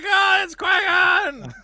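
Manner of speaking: high, loud and slow; Qui-Gon is back, said with glee; excited. Real, not acted. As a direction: cry it out with mock delight. Reacting to Qui-Gon's return.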